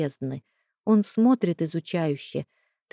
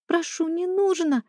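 much higher, squeaky plea; squeaking like a mouse; 11 semitones higher than usual. Acted. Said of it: "запищала она, словно мышка".

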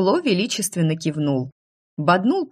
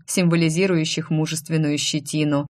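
plain reading voice

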